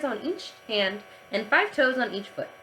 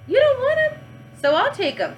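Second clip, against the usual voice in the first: eager pushy offer; 12 semitones higher, 5 dB louder than usual. Acted. Yard teacher pushing for her toes.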